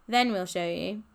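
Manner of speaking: usual speaking voice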